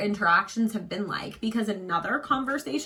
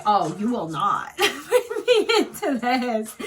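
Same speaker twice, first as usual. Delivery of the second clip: laughing mock anger; 3 semitones higher, 3 dB louder, slower than usual. Ready to fight girl in dream.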